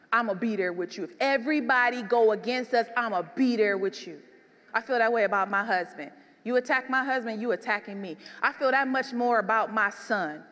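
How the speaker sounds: normal talking voice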